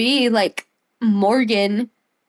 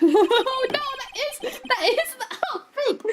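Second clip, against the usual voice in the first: high squealing laughter through words; laughing hard; 5 dB quieter than usual. Real, not acted. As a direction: laugh helplessly mid-sentence.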